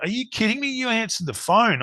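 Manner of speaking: high-pitched, disbelieving retold question; incredulous mate. Mate on the phone stunned he picked up at 2 a.m., high incredulous voice. Mate amazed he answered at 2am.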